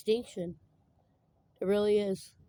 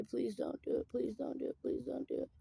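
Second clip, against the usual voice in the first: quiet pleading; 4 semitones higher, 6 dB quieter than usual. Retold or imitated. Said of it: "Einstein tried to say".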